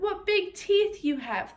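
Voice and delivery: slow, high, wide-eyed amazement; slow wonder. Acted. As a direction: stretch it out in wonder. Girl marvels slowly.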